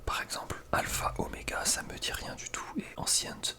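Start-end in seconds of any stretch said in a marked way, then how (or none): none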